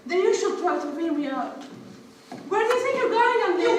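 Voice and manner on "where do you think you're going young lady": loud, higher stern telling-off; scolding parent. Acted. Mother catching her daughter sneaking out, loud scolding. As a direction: stop her in her tracks.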